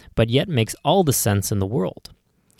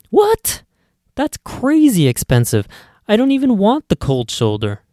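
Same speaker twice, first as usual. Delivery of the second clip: much higher, louder What; indignant, higher squeal at the price; shocked customer; 9 semitones higher, 4 dB louder than usual. Acted.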